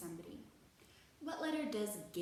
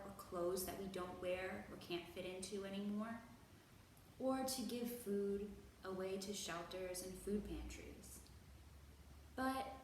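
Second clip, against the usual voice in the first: ordinary talk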